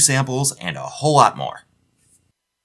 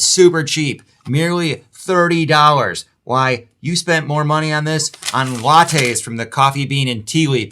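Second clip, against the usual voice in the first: hyped-up sales pitch, higher and louder; excited; 7 semitones higher, 6 dB louder than usual. Acted. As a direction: oversell the bargain like an infomercial. Mock hard sales pitch.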